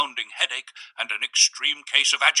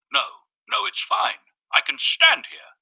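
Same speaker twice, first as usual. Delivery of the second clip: narrator's spoken reply; 11 semitones higher, 4 dB louder, slower than usual. A narrator's reading. Narrator refusing the seat.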